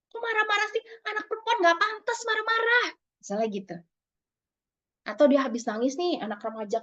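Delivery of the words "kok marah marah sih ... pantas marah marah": high-pitched acted reproach; disapproving parent. Parent telling a daughter anger is unbecoming, high-pitched chiding. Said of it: "ada lagi disapproving parent".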